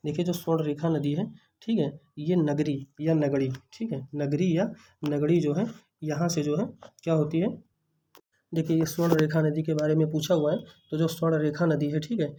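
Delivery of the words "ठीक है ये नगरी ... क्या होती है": ordinary talk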